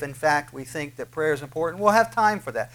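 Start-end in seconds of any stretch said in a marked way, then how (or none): none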